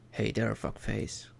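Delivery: a lightly voiced devil, low and slow; low gravelly devil. Devil greeting the stranger, lightly voiced low and slow. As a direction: growl it out slow like a devil at a bar. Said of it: "His voice comes out low and gravelly".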